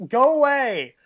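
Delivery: loud, high-pitched go-away; exasperated mock shout at the door; retold irritated yell. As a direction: yell at whoever is knocking. Retold irritation at early door knocking.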